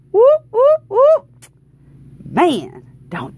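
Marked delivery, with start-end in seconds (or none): 0.1-1.3 high, loud whoop; excited whooping. Real, not acted. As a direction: whoop it up in celebration. Cheering the hundred dollar win.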